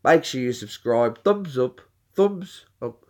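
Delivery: put-on British vlogger sign-off; mock British accent, channel-plug patter; Brad. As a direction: imitate a British pal plugging his channel. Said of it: "I was doing Brad's voice".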